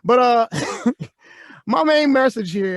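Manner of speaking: ordinary talk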